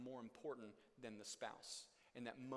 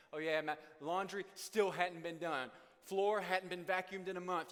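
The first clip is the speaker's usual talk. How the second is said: louder mock nagging; sarcastic complaint; 5 semitones higher, 8 dB louder than usual. Mock critical spouse complaining.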